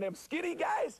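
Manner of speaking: very high mock disbelief; teasing. Real, not acted. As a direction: tease him with a squeaky question. Coach teasing about linemen.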